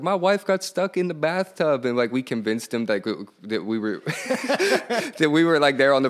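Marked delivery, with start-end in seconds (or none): 0.0-1.8 higher, faked-panic husband on the phone; put-on caller voice. Prank caller to hotel clerk, mock panicked husband. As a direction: play a stranded husband, lightly hammed. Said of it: "And we're like".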